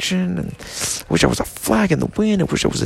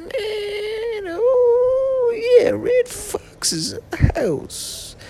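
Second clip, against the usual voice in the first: much higher, strained squeaky put-on voice; Christopher Walken impression; 14 semitones higher than usual. Drunk comic doing Walken for the audience, high mock voice. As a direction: squeeze it up high and ham it up. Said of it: "Then Christopher Walken would sound like".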